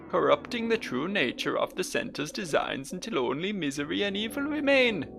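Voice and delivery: high, slow botched Welsh deacon; grand speech in a failed Welsh accent; Welsh accent attempt. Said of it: "I'm going for Welsh here, but I can't do a Welsh accent".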